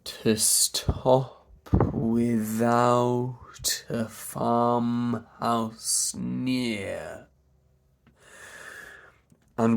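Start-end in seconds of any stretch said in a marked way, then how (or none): 0.0-7.3 slow, joined "To stop without a farmhouse near"; stretched legato "To stop without a farmhouse near"; linked words. Shown as a demonstration. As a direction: run each final consonant of "To stop without a farmhouse near" into the next word. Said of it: "That linking".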